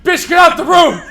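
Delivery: loud high shout at the movie; panicked loud repeated yell; yelling at screen. Retold or imitated. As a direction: scream a warning at the character. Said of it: "that dude in the movie theater going".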